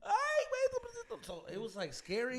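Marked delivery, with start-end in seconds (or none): 0.0-1.2 very high yelped plea; hammed-up pitched-up wail; mock frantic prayer. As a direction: cry out to God in an exaggerated squeal. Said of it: "Fool, rezando".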